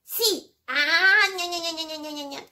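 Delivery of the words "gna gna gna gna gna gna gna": softer chomping gna gna; munching sounds. Acted. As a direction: chew noisily on popcorn. Musetto mangia i popcorn. (masticare rumoroso, gna gna)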